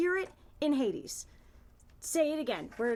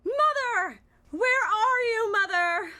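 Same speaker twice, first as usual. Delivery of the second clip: crying shout; 6 semitones higher, 6 dB louder, slower than usual. Acted. Persephone cries, tearful shout.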